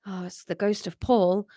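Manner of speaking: usual speaking voice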